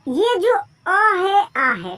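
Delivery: slow deliberate teacher pointing. Shown as a demonstration. Teacher showing students the letter, slow and deliberate. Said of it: "देखिए".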